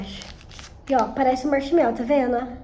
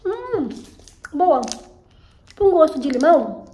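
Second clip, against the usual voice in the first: sucking a hard candy; talking around a sweet; candy in mouth; 3 semitones higher, 5 dB louder, slower than usual. Real, not acted. Seen 1: candy put in mouth at -3; pursed working lips while speaking.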